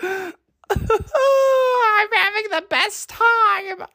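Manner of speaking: very high, drawn-out wail of glee; hysterical squeal. Real, not acted. Host giddy over own joke, high hysterical squeal. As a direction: squeal it slowly, near-crying with laughter.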